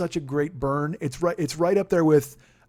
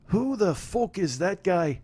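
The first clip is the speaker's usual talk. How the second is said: McGregor put-down; 3 semitones higher, slower than usual. Retold or imitated. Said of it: "repeats McGregor line".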